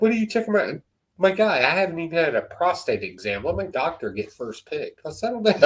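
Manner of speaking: high-pitched mock indignation; mock protest. Traveller objecting to an airport examination, comic indignant protest.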